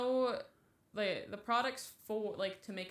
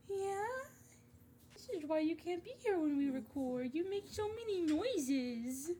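much higher sing-song puppy talk; 6 semitones higher than usual. Real, not acted. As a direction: coo at the puppy, high and sweet. Keeps talking to her puppy.

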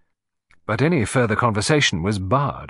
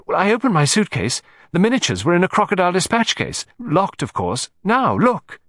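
distressed; 8 semitones higher, 3 dB louder than usual. A narrator's reading. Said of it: "large tears standing in her eyes".

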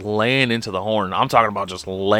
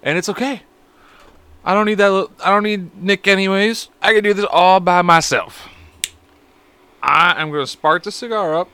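mock defiant; 8 semitones higher than usual. Insisting he doesn't need Nick.

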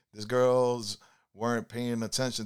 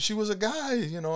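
surprised reveal; 11 semitones higher, 4 dB louder than usual. Real, not acted. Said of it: "punchline reveal, high and loud".

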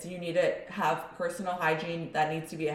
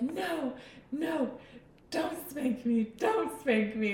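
put-on pleading child; 8 semitones higher, slower than usual. Son pleading during car tantrum.

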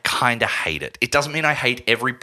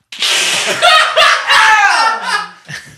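loud high screaming laugh; real high shriek of laughter; 10 dB louder than usual. Real, not acted. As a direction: burst into a howling cackle.

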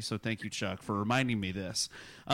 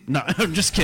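laughing it off, louder; 5 semitones higher, 8 dB louder, faster than usual. Real, not acted. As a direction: chuckle through the words. Laughing off his own joke.

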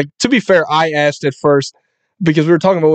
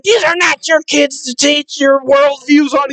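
high, slow, emphatic telling-off; high-pitched wife lecture; imitated scolding wife; 11 semitones higher, slower than usual. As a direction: draw out each word in mock outrage. Wife scolding husband, retold.

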